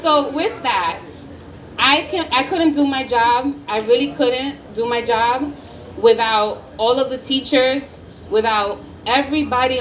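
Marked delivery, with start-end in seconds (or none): none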